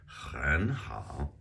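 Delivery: "很好" with a throaty, scraped h; aspirated H. Shown as a demonstration. Teacher showing the aspirated H, throaty h in "很好". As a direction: exaggerate the h in "很好", 很 up, 好 dipping. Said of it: "here you get that aspirated H".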